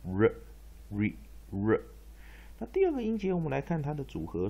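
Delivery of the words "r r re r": "re" said as a quick weak r sound; reduced first syllable demo. Shown as a demonstration. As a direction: clip "re" down to a light r. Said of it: "所以念".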